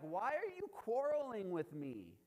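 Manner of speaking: high, loud protest; exasperated Moses. Retold or imitated. Moses answers the quarreling people, exasperated protest. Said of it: "And Moses is like".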